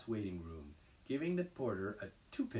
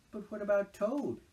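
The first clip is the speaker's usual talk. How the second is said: higher, louder worried question; anxious; 12 semitones higher, 9 dB louder than usual. A narrator's reading. Mole anxiously asks Rat about Toad. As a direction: fret about leaving him. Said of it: "asked Mole anxiously".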